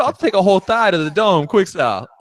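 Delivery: high, loud and boastful; excited bragging. Real, not acted. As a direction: shout it out like a boast. Bragging about swallowing chicken.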